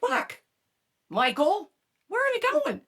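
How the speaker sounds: high, startled complaint at Michael; mock exasperated cry. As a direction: yelp it like you just saw the road signs. Lost while driving, exasperated at Michael.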